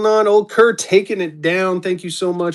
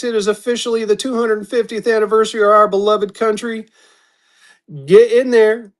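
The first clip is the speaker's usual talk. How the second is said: upbeat host pitched higher, announcing the lot; auction announcer; 6 semitones higher than usual. Acted. Live seller announcing the lot to viewers, in a bright announcer voice. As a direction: sell it to the stream like a showman.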